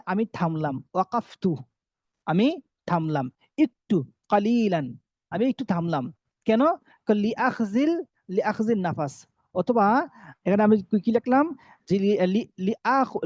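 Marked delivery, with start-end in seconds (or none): none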